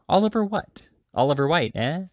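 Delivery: high-pitched, querying surprise; puzzled questioning. A narrator's reading. Brownlow queries the boy's name, puzzled and surprised. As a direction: press the question, pitch jumping up.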